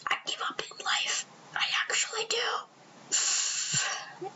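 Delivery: breathy mock-despairing whisper, drawn out; mock-tragic whispered aside. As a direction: sigh it away like giving up on life.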